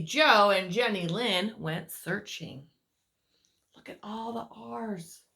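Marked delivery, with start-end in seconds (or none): none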